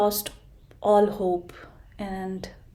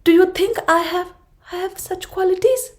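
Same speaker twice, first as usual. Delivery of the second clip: much higher, fast, wondering voice; hopeful little tortoise; 11 semitones higher, 5 dB louder, faster than usual. Acted. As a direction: ask in a tiny hopeful voice. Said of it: "giraffe and said".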